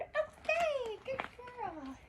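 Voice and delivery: gushing high praise to puppy; high soft praise. Real, not acted. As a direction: coo it up high.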